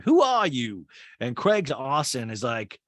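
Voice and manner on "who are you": higher, louder indignant snap; mock-offended retort; imitating Whitney. Host mimics Whitney snapping back at Olivia, higher indignant. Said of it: "And Whitney's like".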